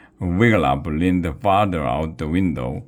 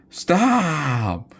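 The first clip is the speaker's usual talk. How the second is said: desperate cry; 10 semitones higher than usual. Acted. Wiglaf cries stop while Grog shakes him. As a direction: beg him to stop shaking you. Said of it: "cried Wiglaf".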